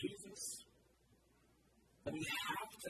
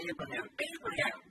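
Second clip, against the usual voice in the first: louder, urgent double call; urgent shout; 8 semitones lower, 6 dB louder than usual. Acted. Jesus calls Abraham to stop, urgent shout. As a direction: call out the name twice with urgency. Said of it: "he has to shout twice".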